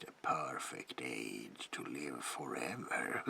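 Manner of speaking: ordinary talk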